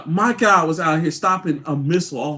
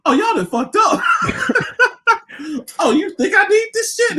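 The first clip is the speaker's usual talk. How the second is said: hammed-up cocky villain taunt, higher; lightly voiced smug Wesker; mock villain; 11 semitones higher than usual. Mocking Wesker to friend, hammed-up taunt. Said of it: "Wesker was just like".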